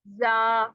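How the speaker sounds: जा with a plain z buzz; wrong za sound. Shown as a demonstration. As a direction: let जा come out like z. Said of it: "जा वाली sound आएगी". (गलत ज़ वाली आवाज़ में जा)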